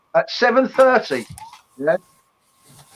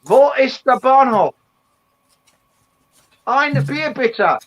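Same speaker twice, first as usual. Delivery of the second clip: German; 5 semitones higher, slower than usual. Pretend. Tourist German phrases for Jane.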